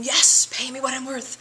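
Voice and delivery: loud, confident demand; bold demand. Retold or imitated. Coach voicing a bold coastal businesswoman, loud and confident. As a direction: bang the drum and ask for your worth. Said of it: "out there banging the drum, saying".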